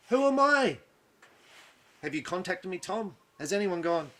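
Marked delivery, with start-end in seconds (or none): 0.1-0.8 high, loud outraged disbelief; a sharp cry of who am I; incredulous. Retold or imitated. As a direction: throw it out, high and stung. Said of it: "I'm like".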